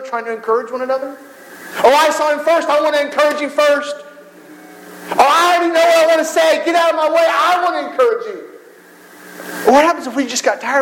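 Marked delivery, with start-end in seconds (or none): 1.8-9.7 high, jostling and eager, with a mock shout; eager rivals. Rival churchgoers elbowing each other to encourage first, high and eager. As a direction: push to be first to encourage someone.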